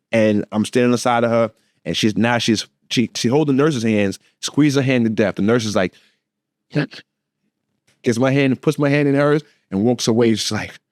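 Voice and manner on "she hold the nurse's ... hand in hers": usual speaking voice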